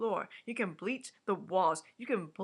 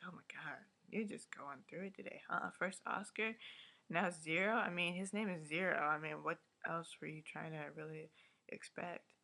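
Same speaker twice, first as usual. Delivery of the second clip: dry aside; 2 semitones lower, 7 dB quieter than usual. Retold or imitated. Her own sarcastic reply to Jude.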